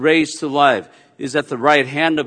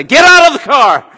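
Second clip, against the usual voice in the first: higher, louder fed-up father; mock angry dad; 12 semitones higher, 10 dB louder than usual. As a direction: bark it like a dad at the end of his rope. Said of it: "He does not drive and say".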